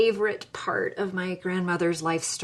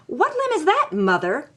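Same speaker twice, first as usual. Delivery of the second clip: much higher, biting sarcasm; sarcastic retort; 9 semitones higher, 4 dB louder than usual. Retold or imitated. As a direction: needle your mother with a mock question. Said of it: "I say".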